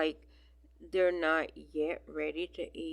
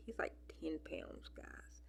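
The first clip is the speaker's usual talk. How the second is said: whisper; 13 dB quieter than usual. Real, not acted. Gushing aside about kitten.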